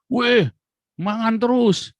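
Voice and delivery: playful Javanese jibe; teasing relative. Retold or imitated. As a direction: tease him for stuffing his face. Relative teases him for eating.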